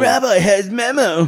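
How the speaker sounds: put-on high, childish Ralph quote; lightly voiced dim kid; Ralph Wiggum voice. Podcaster quoting the kid Ralph, childish.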